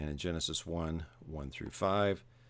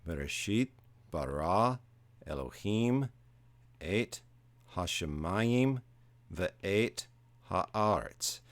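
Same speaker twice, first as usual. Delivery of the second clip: "Bereshit", "bara", "Elohim" stressed on their last syllables; "hashemayim" and "haaretz" leaning on the next to last syllable, each word separate; stressed-syllable demo; slower than usual. Shown as a demonstration. Teacher showing where the accent falls, deliberate syllable stress in "Bereshit bara Elohim". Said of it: "as I read it again, that the accent does fall on the syllable that's stressed".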